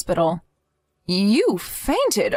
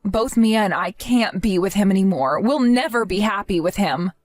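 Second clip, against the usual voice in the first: ordinary delivery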